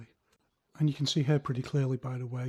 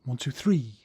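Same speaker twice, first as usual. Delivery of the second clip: three punched out, louder; stress on three; 6 dB louder than usual. Shown as a demonstration. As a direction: finish hard on three. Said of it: "change the emphasis back to the more old school way".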